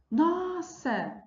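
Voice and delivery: high-pitched exclamation of surprise; teacher modelling surprised intonation. Shown as a demonstration. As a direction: gasp it out in astonishment. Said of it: "é um espanto".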